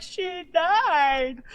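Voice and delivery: high, drawn-out wail; hammed-up tearful cry; mock bawling. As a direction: wail it as if crying, played for laughs. Acts out her bawling reply.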